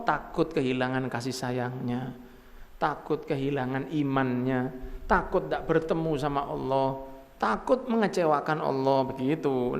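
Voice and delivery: normal talking voice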